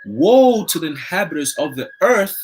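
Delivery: slow, solemn Bible reading; scripture reading. Acted. Reading Revelation to listeners, slow solemn scripture voice. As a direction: intone the verse slowly like a preacher.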